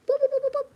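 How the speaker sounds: high, loud playful boop sounds. Real, not acted. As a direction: bop each word like a cartoon. Playful sound while reading cards.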